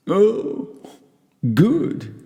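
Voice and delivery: put-on squeaky high drunken approval; drunken Fortunato. A narrator's reading. Tipsy Fortunato approving the motto, squeaky high. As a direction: pitch it way up, giddy drunk.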